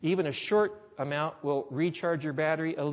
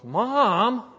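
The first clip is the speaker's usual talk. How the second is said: son cries out Mom in loud alarm; a sudden high, loud protest; alarmed shout; 7 semitones higher, 9 dB louder than usual. Retold or imitated. Son shocked that mother gave him to God, loud startled cry.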